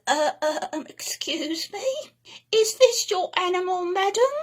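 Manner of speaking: hesitant manager, half whispered then rising high; nervous spluttering. Acted. Manager nervously asking the lady about her animal. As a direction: stammer out the question, scared of the beast. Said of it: "he spluttered nervously".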